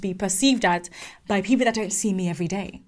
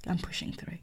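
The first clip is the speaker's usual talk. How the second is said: I'm pushing through whispered, much softer; sulky whisper; 4 semitones lower, 16 dB quieter than usual. Retold or imitated. Young hire to the CEO, whispered defeated sulk. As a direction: breathe it out under your breath, defeated. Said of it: "If you had spoken to me like this".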